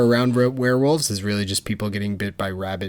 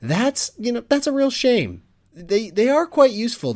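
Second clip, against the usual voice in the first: high, put-on pity; sing-song fake commiseration, pitched way up; mock sympathy; 11 semitones higher than usual. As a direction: gloat while pretending to be sorry. Acted mock sympathy to Draco.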